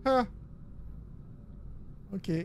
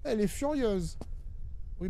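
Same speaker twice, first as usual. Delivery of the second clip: normal talking voice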